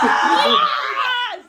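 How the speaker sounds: laughing victory shout; triumphant shout. Real, not acted. Cheering after winning Wii Sports, laughing shout. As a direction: yell it as you win.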